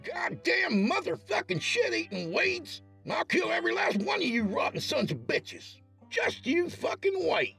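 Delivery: high, furious neighbor cursing weeds. A narrator's reading. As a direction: rage at the weeds like a hillbilly. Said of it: "began shouting obscenities".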